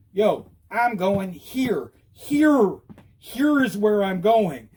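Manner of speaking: slow, emphatic call with HERE punched; insistent, stretched-out announcement; drastic signal. Shown as a demonstration. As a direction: drag it out so drivers get it. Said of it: "I'll go drastic".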